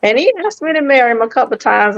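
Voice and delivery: normal talking voice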